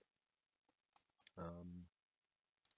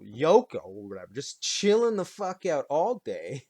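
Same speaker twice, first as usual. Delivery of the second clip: usual speaking voice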